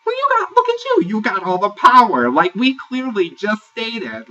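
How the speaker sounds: high, loud mocking impression of Red; imitating Red. Reality-show recapper mimicking Red's loud put-down. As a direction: squeak it out high and pushy like Red. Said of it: "Red goes".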